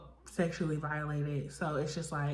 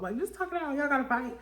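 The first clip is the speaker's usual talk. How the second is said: high mock voice; 9 semitones higher, faster than usual. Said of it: "mocking the girls".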